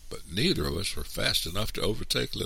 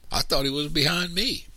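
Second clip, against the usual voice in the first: put-on very high little-boy voice; young boy; 12 semitones higher than usual. A narrator's reading. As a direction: answer as a small child. Young brother answers, worried.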